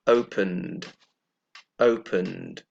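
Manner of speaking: "opened" low, ending in a voiced d, two syllables; model "opened". Shown as a demonstration. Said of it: "So it becomes".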